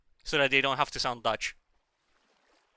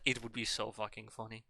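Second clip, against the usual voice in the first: ordinary talk, nothing marked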